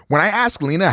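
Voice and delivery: ordinary delivery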